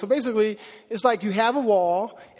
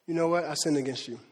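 humble confession; 6 semitones lower, 6 dB quieter than usual. Retold or imitated. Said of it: "has the maturity to say".

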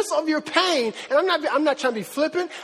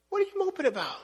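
high, softer baffled question; exasperated dad; 6 semitones higher, 6 dB quieter than usual. Acted. Dad to moping son, exasperated. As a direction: ask it higher and quieter, in disbelief. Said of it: "the most frustrating thing for me as a parent".

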